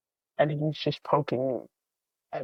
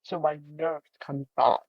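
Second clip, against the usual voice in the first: ordinary delivery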